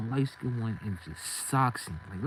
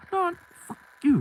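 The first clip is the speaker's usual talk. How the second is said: much higher, louder burst; sudden high yell; 8 dB louder than usual. Retold or imitated. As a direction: snap it out defiantly. Snapping back at robber.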